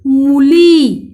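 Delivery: मूली very slow and loud; pronunciation demo. Shown as a demonstration. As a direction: stretch both vowels of मूली. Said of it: "उच्चारण देखो".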